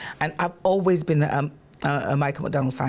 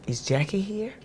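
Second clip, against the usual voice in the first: 2 softer, breathy enquiry; 3 lightly voiced [name] asking; 1 soft call; 4 semitones higher, 4 dB quieter than usual. 4 [name] asking for her from the stage, softly.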